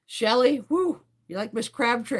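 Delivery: ordinary talk, nothing marked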